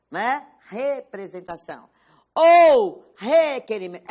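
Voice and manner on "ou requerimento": "requerimento" loud and slow; stressed key word. Shown as a demonstration. Teacher marking the exam keyword, loud and slow. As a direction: hammer home "requerimento", slowly. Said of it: "Essas são palavrinhas".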